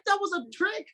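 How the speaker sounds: a sudden high "trick?" in mock shock; mock shock at being tricked; high surprised disbelief. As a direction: sound caught off guard and amazed. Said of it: "And I'm like".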